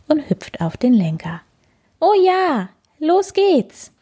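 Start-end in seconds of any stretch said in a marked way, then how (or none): 2.0-3.9 high, eager cheer; excited little bird. Acted. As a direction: pipe up high, raring to go. (begeisterte, hohe Vogelstimme)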